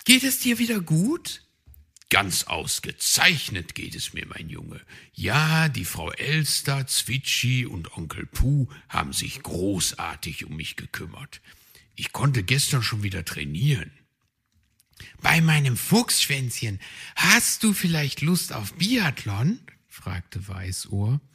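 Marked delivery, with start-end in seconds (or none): 0.0-1.4 high, concerned little hare; young hare Weißohr asking after the fox. Acted. 2.1-13.9 lightly voiced kindly fox; lightly voiced old fox. Herr Fuchs answers. 15.2-19.6 high, concerned little hare; young hare Weißohr asking after the fox. Acted. Weißohr asks again.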